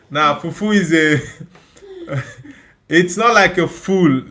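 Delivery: laughing. Real, not acted. Laughing while correcting a viewer.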